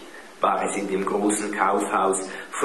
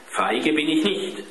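defensive; 7 semitones higher than usual. A narrator's reading. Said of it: "wehrte sich Klaus".

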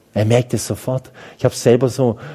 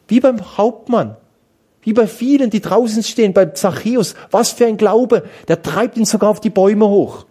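high, ringing preacher's voice at full force; fervent preaching; 10 semitones higher, 4 dB louder than usual. Acted. Priest preaching to his congregation about faith, fervent and high. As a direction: preach it out with rising fervour.

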